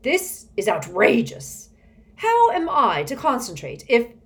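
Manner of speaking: loud, indignant outburst; frustrated. A narrator's reading. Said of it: "face twisted with frustration".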